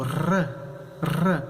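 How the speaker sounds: a long trilled r, rolled several times; Spanish multiple r, trilled. Shown as a demonstration. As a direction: roll the r hard on the tongue tip. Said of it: "ele vai ter um som múltiplo".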